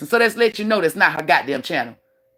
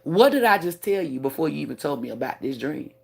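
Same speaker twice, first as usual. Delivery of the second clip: retold quiet reminder; 7 semitones lower, 6 dB quieter than usual. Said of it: "I said".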